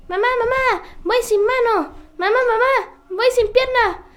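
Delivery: put-on high excited child calling mom; squeaky boastful kid; little kid voice. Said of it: "que le dijo a su mamá".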